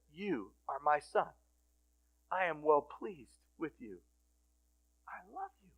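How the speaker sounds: soft, unhurried words of love; tender affirmation. Retold or imitated. Jesus to each listener, tender and hushed. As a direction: drop to a hush for the last words. Jesus looking at you, speaking.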